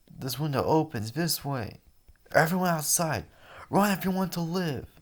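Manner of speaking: high, loud, urgent shouting; panicked students. Acted. Students fleeing the gunman, shouting high and urgent. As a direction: yell for everyone to flee. Characters panicking under gunfire.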